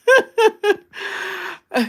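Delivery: high giggling burst of laughter. Real, not acted. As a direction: laugh it off brightly. Laughs after her own affirmation.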